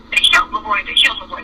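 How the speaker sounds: usual speaking voice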